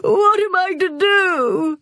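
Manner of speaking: high, loud sobbing lament; wailing. A narrator's reading. Benny wakes with a start, high hammed-up wail. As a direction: sob out the oh-hos, then wail. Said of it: "woke with a start and wailed again".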